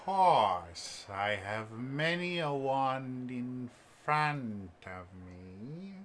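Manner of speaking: put-on very slow, drawn-out old merchant; elderly elf shopkeeper. Old elf presents his wands to a customer, slow and theatrical. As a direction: linger on each word, savoring the wares. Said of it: "elderly, um, elven-looking person".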